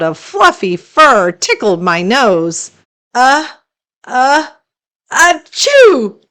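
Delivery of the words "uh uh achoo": high building sneeze; uh, uh, then a loud achoo. Acted. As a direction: monster sneezing at the lamp. Monster sneezing from fur.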